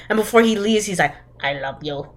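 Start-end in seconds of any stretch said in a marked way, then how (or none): 1.4-2.1 low, soft declaration; mock brooding lover. Friend imitating the mobster before he leaves, mock brooding lover. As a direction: drop low and quiet like a movie heartthrob.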